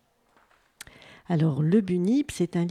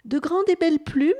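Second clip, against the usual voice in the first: high, louder, hopeful question; enthusiastic; 7 semitones higher, 5 dB louder than usual. A narrator's reading. Bunip asks wallaby about fine feathers, enthusiastic. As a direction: lift the voice with eager hope. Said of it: "demanda le bunip enthousiaste".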